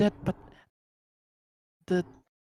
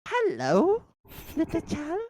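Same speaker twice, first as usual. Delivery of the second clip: high sugary greeting; 14 semitones higher than usual. Acted. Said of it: "I'd be like".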